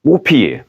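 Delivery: Roupiller pitched high and stressed; emphasised word. Shown as a demonstration. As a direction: present Roupiller brightly as the new word. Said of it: "C'est un autre verbe".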